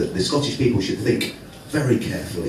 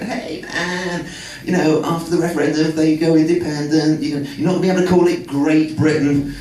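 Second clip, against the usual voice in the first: bad American accent; 5 semitones higher than usual. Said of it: "I can't do an American accent either".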